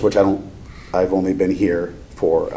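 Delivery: ordinary delivery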